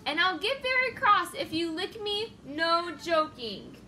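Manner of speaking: loud, cross warning; very loudly. Acted. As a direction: loudly tell them to stop poking. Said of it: "The Fluffalump said very loudly".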